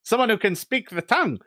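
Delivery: high, squeaky little kobold. Acted. Kobold guard greeting the Draconic speaker, squeaky and high. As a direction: chirp it like a pleased little lizard. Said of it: "One of them looks at you and goes".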